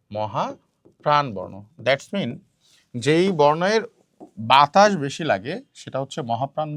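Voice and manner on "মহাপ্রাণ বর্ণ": slow. Shown as a demonstration. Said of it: "teacher repeats term slowly".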